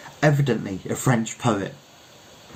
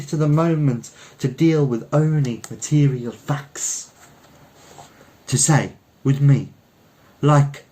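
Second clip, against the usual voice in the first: higher, intense declaiming Frenchman; French poet orator; 5 semitones higher than usual. Acted. Poet's long oration continues.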